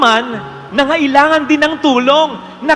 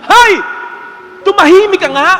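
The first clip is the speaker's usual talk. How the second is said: annoyed; 6 semitones higher than usual. Retold or imitated. Annoyed bystander retort, punchline.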